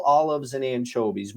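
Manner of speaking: normal talking voice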